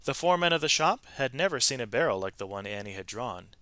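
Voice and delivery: lively read-aloud storytelling voice; expressive storybook narration; story narration. A narrator's reading. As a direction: tell it like a picture book to children. Reads a picture-book story aloud.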